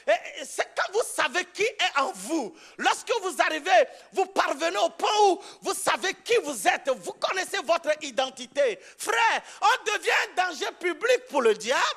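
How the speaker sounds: loud, driving sermon cadence; fiery preaching. Acted. Pastor rallying the congregation, preaching at full force. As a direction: preach it out to the whole church.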